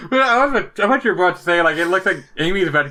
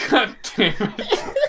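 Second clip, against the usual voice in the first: laughing; 9 semitones higher, slower than usual. Real, not acted. Laughing at comic panel.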